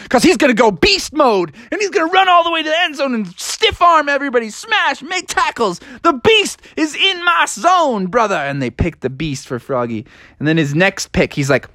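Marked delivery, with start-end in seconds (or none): 0.1-8.4 high-pitched sports hype, barrelling along; excited, high-voiced trash talk; hyped-up boasting. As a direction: pump up the crowd about your star player. Froggy hypes the Beast.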